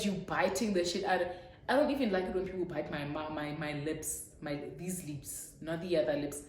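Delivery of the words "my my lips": ordinary talk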